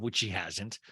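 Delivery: low, soft narrator's correction; Arrested Development narrator aside. Acted. Podcaster corrects Jen like a show narrator, low aside. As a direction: drop down into a dry voice-over aside. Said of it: "that's the Arrested Development".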